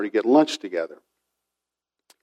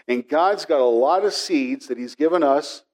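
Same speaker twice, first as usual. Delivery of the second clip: normal talking voice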